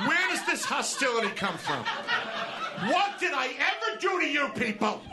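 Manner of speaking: high, hammed-up wounded whine; over-the-top self-pitying complaint; mock outrage. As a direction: play the martyr to the laughing crowd. Mock self-pity rant at audience.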